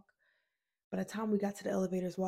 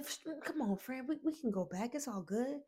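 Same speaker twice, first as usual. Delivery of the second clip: pleading friend, raised pitch; higher coaxing voice; 5 semitones higher than usual. Retold or imitated. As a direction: coax her gently, pitched up.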